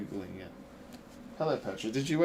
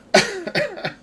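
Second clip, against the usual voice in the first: high-pitched laugh; 5 dB louder than usual. Real, not acted.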